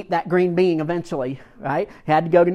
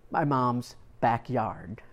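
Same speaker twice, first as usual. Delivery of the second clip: ordinary talk, nothing marked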